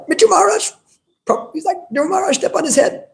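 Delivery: very high, excited exclamation; shrill with ecstatic fervor. Retold or imitated. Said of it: "rah, and he, like, started talking".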